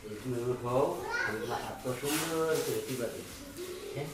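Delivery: low, soft persuasion; hushed coaxing. Acted. Man to his sister-in-law offering a massage, hushed coaxing. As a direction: coax her furtively, keeping it down.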